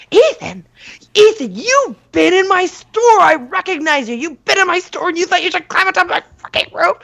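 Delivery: high, scolding yell; mom yelling. Retold or imitated. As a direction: tear into him like an outraged store owner. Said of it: "she was just yelling".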